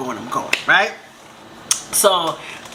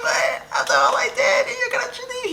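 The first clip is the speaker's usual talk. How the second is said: high, whining tearful plea; hammed-up crying voice; mock crying. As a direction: wail it like you're being abandoned. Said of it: "and I start crying".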